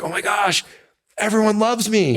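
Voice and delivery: overexcited, laughing at his own giddiness; giddy self-mockery. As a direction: squeal it like a kid who just got praised. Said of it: "it's like".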